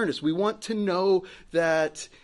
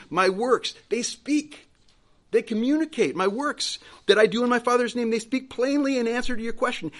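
emphatic preaching; 6 semitones higher, 5 dB louder than usual. Acted. Preacher voicing Jesus' reply.

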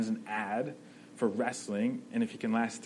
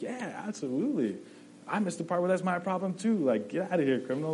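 mock smug agreement; 7 semitones higher than usual. Preacher voicing his own reaction.

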